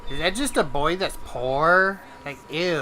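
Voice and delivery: mocking Northeasterner. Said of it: "they're like".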